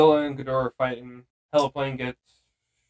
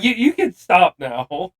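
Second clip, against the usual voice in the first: incredulous; 5 semitones higher, 7 dB louder, slower than usual. Retold or imitated. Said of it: "And Mark's like".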